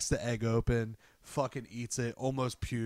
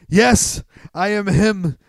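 loud, high triumphant announcement; hammed-up heroic reveal; heroic proclamation; 8 semitones higher, 12 dB louder, slower than usual. Hero reveals luchador outfit.

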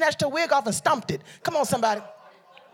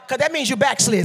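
ordinary talk

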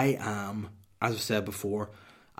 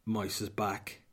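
ordinary talk, nothing marked

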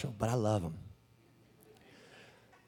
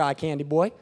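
ordinary talk, nothing marked

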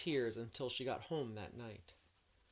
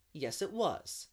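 louder, defiant, unashamed reply; insolent; 4 semitones higher, 5 dB louder, slower than usual. A narrator's reading. Boy admitting the prank to his teacher, insolent. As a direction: throw the answer back at her. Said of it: "said Anthony insolently".

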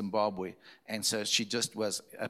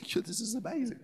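put-on much higher, laughing girl's voice; imitated amazed girl; 11 semitones higher than usual.

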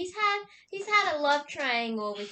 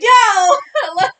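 loud, high retold yell; whooping exclamation, acted out; excited outburst; 9 semitones higher, 17 dB louder than usual. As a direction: shout it out in hyped-up surprise.

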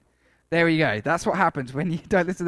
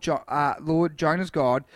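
ordinary delivery

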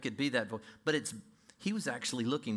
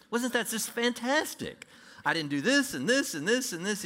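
smug religious Pharisee; 8 semitones higher, 4 dB louder than usual. Pretend. A believer sounding like a smug Pharisee, higher and self-satisfied. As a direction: gloat over all you didn't do. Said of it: "you sound like a religious Pharisee".

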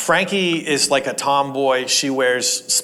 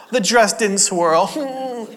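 mock whine; 7 semitones higher, much slower than usual. Mocking petulant complaint.

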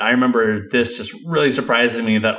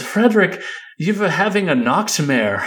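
put-on German accent; 7 semitones higher than usual. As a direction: quote Inga in a German accent. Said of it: "with this fake German accent".

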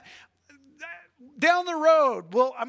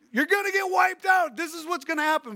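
high, loud mock doom cry; prophet's warning; 8 semitones higher, 3 dB louder than usual. Preacher mimicking the prophets warning of doom. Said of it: "so many of the prophets".

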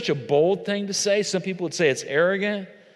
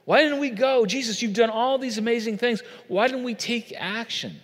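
higher, pleading, baffled questions; confused disciples; 8 semitones higher than usual. Acted. Disciples confused, asking Jesus why they waited: higher baffled pleading. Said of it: "which they're so confused".

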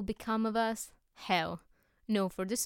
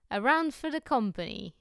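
loud, higher-pitched call to the room; hearty raised voice, ordering drinks; cried out; 4 semitones higher, 7 dB louder than usual. A narrator's reading. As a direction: call out a round for everyone. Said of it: "he cried".

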